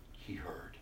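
breathy hushed whisper; unvoiced whisper of reverence. Real, not acted. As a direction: breathe it softly.

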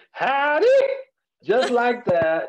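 a drawn-out, high-pitched "Howdy"; Minnie Pearl howdy. Retold or imitated. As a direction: sing out a stage greeting like a country comic. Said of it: "she would walk on stage and say".

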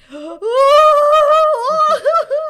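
loud, very high sobbing gasp; mock sob gasp. Acting out her ugly crying in the car, a loud gasp.

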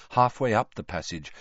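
plain reading voice

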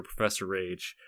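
ordinary talk

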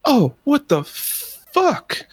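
high, slow freaked-out cry; panicked chef. Retold or imitated. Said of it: "my chef's freaking out".

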